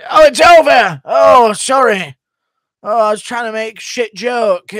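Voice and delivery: high shouting English commentator; Bisping impression. Doing Bisping for a viewer, excited commentator shout. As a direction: yell it like a stunned fight caller. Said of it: "Can you give me a Bisping?".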